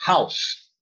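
"House" said like Portuguese "ráus", stressed on the a; correct house. Shown as a demonstration. Teacher explaining with Portuguese spelling, correct "House" like "ráus". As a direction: say "House" as one syllable, breathed h and final s. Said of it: "como se em português tivesse escrito R A U S".

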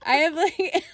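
high, loud helpless laughter through the words; laughing hard. Real, not acted. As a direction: crack up while talking, pitch shooting up. Cracking up with the friend.

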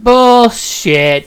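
loud, high scoffing call; scoffing bullshit. Retold or imitated. Scoffing at a coworker's brag, loud and high. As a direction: shout it down as a lie.

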